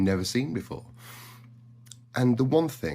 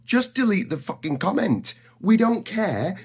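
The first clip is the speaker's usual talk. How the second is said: exasperated outburst; 9 semitones higher, 3 dB louder than usual. Real, not acted. Ranting about rage bait videos.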